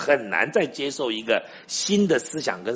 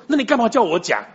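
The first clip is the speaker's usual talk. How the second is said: high, louder exasperated comeback; indignant retort; 9 semitones higher, 5 dB louder than usual. Retold or imitated. Couple protesting to the pastor, exasperated and high. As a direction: throw the question back in protest.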